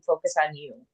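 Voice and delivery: soft repeat. Real, not acted. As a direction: say "Focus on you" again softly, like a reminder.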